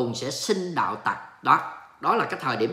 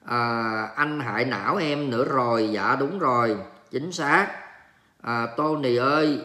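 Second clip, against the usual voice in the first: ordinary delivery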